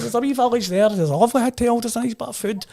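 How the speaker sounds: put-on loud, high old local man's Shooter accent. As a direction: play the old boy with a thick Shooter accent, pitched well up. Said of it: "You know, that Shooter accent".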